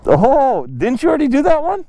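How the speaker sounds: ordinary talk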